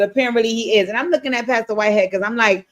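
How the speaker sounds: ordinary delivery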